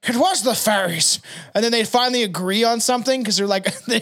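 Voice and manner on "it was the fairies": mock Scottish exclamation; Scottish accent. Brawling Scotsman blames the fairies, Scottish accent. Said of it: "And then the Scottish are like".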